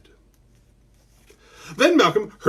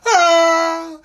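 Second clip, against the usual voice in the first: high, loud mock scream; mom's freak-out scream; 12 semitones higher, 6 dB louder than usual. Mom freaking out for the kids, high mock scream. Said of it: "My mom's gonna freak out".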